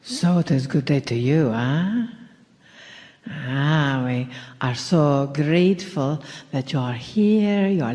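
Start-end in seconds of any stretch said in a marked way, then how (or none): none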